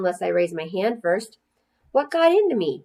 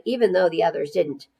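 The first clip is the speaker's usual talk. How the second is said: plain reading voice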